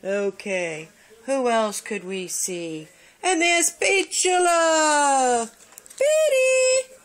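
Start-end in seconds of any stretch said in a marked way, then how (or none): 3.2-5.5 slow, drawn-out delighted call; slow excited shout-out; excited announcement. Real, not acted. Announcing the next cat. 6.0-7.0 squealing the pet name up high; high call. Real, not acted. Calling the cat.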